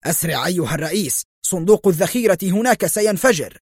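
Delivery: fast, high-pitched urgent warning; acted panic; alarmed cry. A narrator's reading. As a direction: rush out the warning, climbing in pitch. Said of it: "هتف الرجل مرة أخرى".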